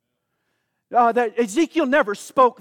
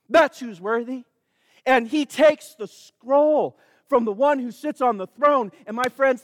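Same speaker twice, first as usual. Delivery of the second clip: ordinary talk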